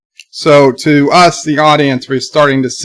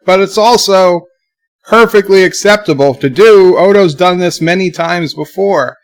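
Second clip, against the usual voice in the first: emphatic; 5 semitones higher, 4 dB louder than usual. Real, not acted. Excited defense of Odo.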